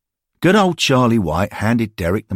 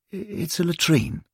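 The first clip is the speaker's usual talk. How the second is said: plain reading voice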